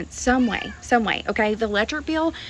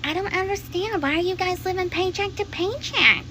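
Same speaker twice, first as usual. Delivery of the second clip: higher, mock-clueless voice; mocking people; 8 semitones higher than usual. Mocking people who ask about paycheck living, higher voice. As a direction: parody the clueless questioners. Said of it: "And people are like".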